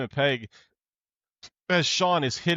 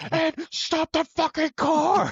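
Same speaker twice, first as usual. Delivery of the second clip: much higher, slow, fed-up yell at Ed; shouted demand; 4 dB louder, slower than usual. Retold or imitated. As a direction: lose patience and yell each word out. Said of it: "He's like".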